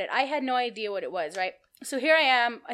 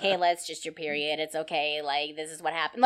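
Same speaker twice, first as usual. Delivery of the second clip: lower, nasal mom voice reassuring her daughter; imitating mom's nasal voice; 7 semitones lower than usual. Mom comforting her scared daughter, imitated nasal voice. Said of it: "I'm trying to imitate her voice, like, her nasally".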